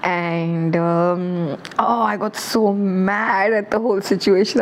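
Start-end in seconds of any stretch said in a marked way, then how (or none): none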